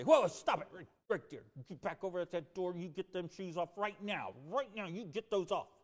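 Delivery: mom yelling, starting with a loud burst; scolding mom. Retold or imitated. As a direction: snap like a mother at muddy shoes. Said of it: "and Mom would say".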